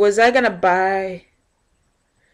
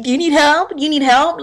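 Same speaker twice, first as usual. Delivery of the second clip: higher, nagging clerk voice; mock pushy clerk; 8 semitones higher than usual. Clerk following her around the store, mock nagging. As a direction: parody a hovering clerk. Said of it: "somebody's behind you asking".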